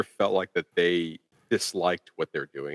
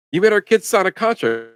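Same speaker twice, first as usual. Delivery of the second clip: high, louder imitation of an outraged mother; mock yelling; 11 semitones higher, 5 dB louder than usual. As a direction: retell it as a high-pitched mock yell. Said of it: "starts yelling at him".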